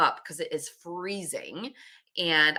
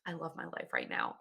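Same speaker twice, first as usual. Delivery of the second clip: much softer, hushed and amazed; hushed awe; 13 dB quieter than usual. Retold or imitated. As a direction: breathe it out in quiet wonder. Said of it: "be like".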